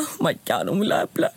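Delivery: rising, overwrought little outburst; mock tearful. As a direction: act as if moved to tears, lightly. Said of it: "jag kunde börja gråta".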